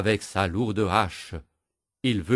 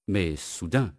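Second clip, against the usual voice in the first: usual speaking voice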